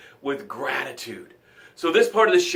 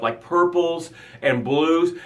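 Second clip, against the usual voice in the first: usual speaking voice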